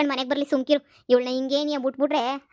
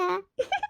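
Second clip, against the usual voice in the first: gleeful giggle; 7 semitones higher, 5 dB quieter than usual. Acted. Gloating alone.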